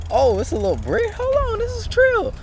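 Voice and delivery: high, thrilled outburst at a find; excited exclamation. Real, not acted. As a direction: blurt it out in delight.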